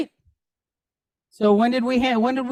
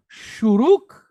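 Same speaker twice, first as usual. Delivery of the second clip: slow shuruk. Shown as a demonstration. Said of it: "Say it with me".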